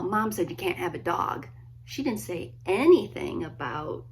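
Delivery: ordinary delivery, nothing marked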